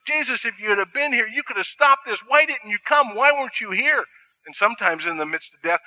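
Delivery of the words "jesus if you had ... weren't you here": higher, pleading voice of the grieving sisters; Mary and Martha pleading with Jesus. Acted. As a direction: lift the pitch and beg Jesus for answers.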